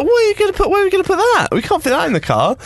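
high mock panicky mum; flustered mum voice. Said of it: "Mum was like".

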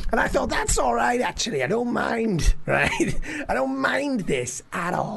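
high, easygoing Scouse; Scouse accent. Acted. Comic accepting his new persona, high Scouse accent. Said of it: "talking in a Scouse accent".

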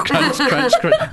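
rising playful crunch crunch; mock crunch sounds. Imitating crunching crisps noisily.

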